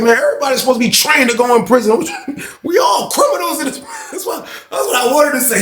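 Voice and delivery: laughing joke. Retold or imitated. Joking with laughter.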